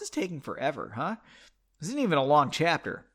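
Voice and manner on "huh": usual speaking voice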